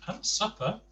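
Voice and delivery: plain reading voice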